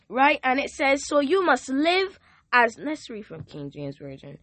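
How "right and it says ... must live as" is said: rising, louder preacher's call to the congregation; full-force preaching. Acted. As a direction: preach it out, lifting the room.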